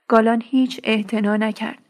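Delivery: usual speaking voice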